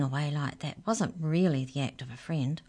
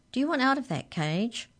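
higher-pitched canine character voice; canine leader; 8 semitones higher than usual. Acted. Canine leader's questions in story.

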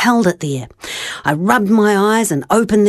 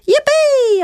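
happy shout. Retold or imitated. Child hearing the coin was found, happy shout. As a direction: burst out with joy. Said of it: "I shouted happily".

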